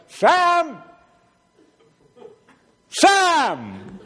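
high, loud holler for a neighbor; yelling out. Acted. Wife rousing the neighbor from her window, yelling. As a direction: call out loudly, stretching the name. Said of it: "and yelled out".

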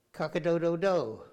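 hammed-up rooster call; mock rooster crow. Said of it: "Can you say cock-a-doodle-doo?".

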